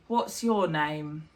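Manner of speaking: slow, low and curt; unwelcoming tone. Shown as a demonstration. Teacher showing an unwelcoming question, cold and curt. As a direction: make it sound cold and unfriendly. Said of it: "it feels a bit unwelcoming".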